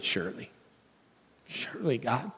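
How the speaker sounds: worn-out believer sighing to God, softly exasperated. Shown as a demonstration. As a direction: breathe it out, spent and done. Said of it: "give an exasperated".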